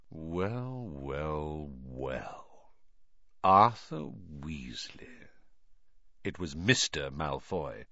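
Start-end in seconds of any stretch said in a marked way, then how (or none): 0.1-5.3 softer, slow, lower aristocrat's greeting; sly aristocrat savouring a rival's arrival; cold drawl. Acted. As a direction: purr the greeting with quiet contempt. Lucius greets his rival coldly.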